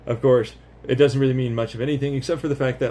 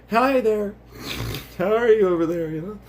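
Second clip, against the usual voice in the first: calling from far away; 8 semitones higher than usual. Acted. Greeting someone far off.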